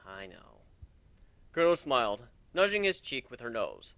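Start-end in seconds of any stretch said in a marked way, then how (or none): 0.0-0.6 soft sigh. Acted. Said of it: "Warren sighed softly".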